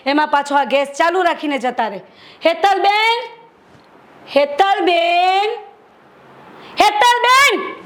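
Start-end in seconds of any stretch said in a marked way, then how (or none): 2.5-5.5 calling her name across the house; summoning a sister-in-law, a calling voice. Acted. 6.8-7.8 fed-up sister-in-law, shouting across the house; angry shout. Acted. Third call, patience gone.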